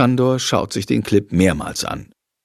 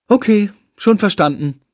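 higher-pitched huffy reply; snippy; 6 semitones higher than usual. A narrator's reading. Lilly brushing off his excuse, snippy. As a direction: answer in a snippy tone. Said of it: "entgegnet sie zickig".

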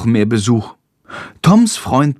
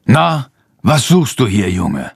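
stern farmer. A narrator's reading. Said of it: "fragt er streng".

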